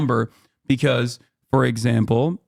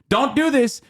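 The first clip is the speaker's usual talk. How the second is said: much higher mock scold; mock scolding quote; 11 semitones higher than usual. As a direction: pitch it way up, mocking the warning. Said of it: "'Cause America says".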